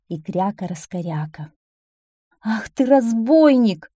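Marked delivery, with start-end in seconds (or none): 2.4-3.9 drawn-out, louder scolding call. A narrator's reading. Old duck to her returning duckling, scolding shout from afar. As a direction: call the rascal from afar, slow and higher. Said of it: "издали крикнула ему старая утка".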